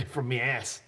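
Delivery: imitated New England accent; Smitty. Host joking about Smitty's origins, mock New England accent. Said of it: "awesome accent".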